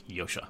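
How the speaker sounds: Yosha said YO-sha, starting on a Y sound. Shown as a demonstration. Host explaining actor's name, Yosha said YO-sha. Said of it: "like a misspelled Joshua, but it's".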